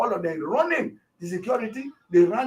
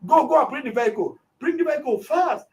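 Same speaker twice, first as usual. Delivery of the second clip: higher, insistent retold command; urgent order; 7 semitones higher than usual. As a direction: push the driver to hurry, pitch climbing.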